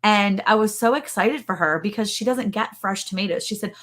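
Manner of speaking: ordinary talk, nothing marked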